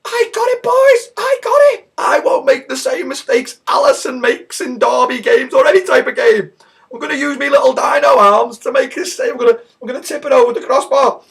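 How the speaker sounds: very high, loud squawking caricature; Dino Hands. Acted. Mocked keeper running across his goal, shrill squawk. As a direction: shriek it like a silly overconfident keeper. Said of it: "Dino Hands comes running across his goal like this".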